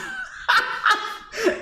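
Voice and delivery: high giggling burst; laughing at her own story; high burst of laughter. Real, not acted.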